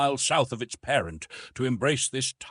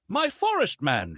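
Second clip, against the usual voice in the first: usual speaking voice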